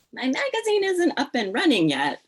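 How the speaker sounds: high, loud and insistent; eager retold plea. Editor pleading for the unfinished project, eager retold plea. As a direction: pitch it up in playful retold eagerness.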